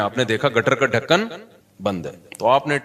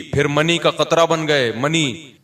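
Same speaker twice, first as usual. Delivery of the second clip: ordinary delivery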